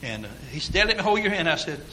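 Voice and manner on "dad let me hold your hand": higher, lightly imitated child; a touch of a small boy's plea; little son. Son insists to father on cliff, lightly voiced child.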